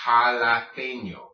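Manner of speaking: jalapeño loud and slow, ha-la-PEN-yo; deliberate, emphatic jalapeño; correct Spanish sounds. Shown as a demonstration. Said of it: "It's pronounced".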